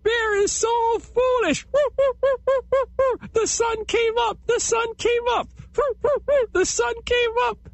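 very high squealing jeering with woos; mocking laughter. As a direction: taunt the bear, laughing and squealing. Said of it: "rolled over and over with laughter".

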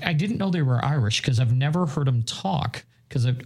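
usual speaking voice

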